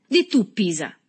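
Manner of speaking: loud call for backing; demanding appeal. A narrator's reading. Boy turns to Agostino as witness, loudly demanding. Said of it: "soggiunse rivolto ad Agostino".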